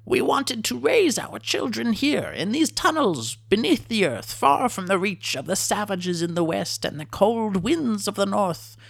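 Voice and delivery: a proud little lizard mourning lost hopes; mournful kobold chief. A narrator's reading. Kobold chief telling of his tribe's lost home, high and sorrowful.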